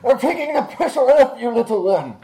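loud, high-pitched combat yell; in-character battle shout. Acted. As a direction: shout it across a fight. Player yells in character mid-fight.

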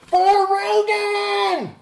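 high, drawn-out war cry; battle cry. Acted. Darian invoking Rogan with sword drawn, shouted battle cry. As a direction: bellow it slowly as you draw the sword. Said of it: "Darian takes out his sword and says".

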